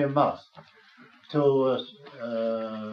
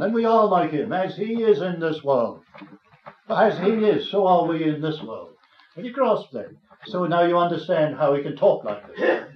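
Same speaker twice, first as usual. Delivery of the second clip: raised, driving sermon voice; fervent preaching; 3 semitones higher, 5 dB louder than usual. Acted. Preacher urging the congregation, fervent and raised. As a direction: press the point home to the congregation. Preaching builds to full force.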